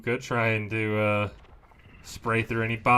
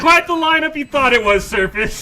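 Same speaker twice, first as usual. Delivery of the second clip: much higher, loud mocking call; excited teasing; 13 semitones higher, 9 dB louder than usual. Real, not acted. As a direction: rub it in with glee. Teasing the player after the play.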